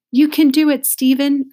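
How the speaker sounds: loud, high-pitched cheer; urging. A narrator's reading. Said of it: "urged Allison".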